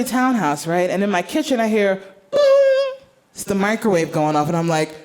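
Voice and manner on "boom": imitated boom. Imitated microwave sound.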